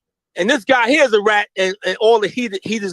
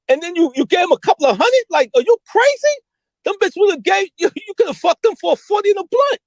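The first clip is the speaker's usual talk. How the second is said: high, incredulous, laughing at the waste; mock him for overpaying; high retold ridicule; laughing disbelief; 11 semitones higher than usual. Retelling scolding Poe.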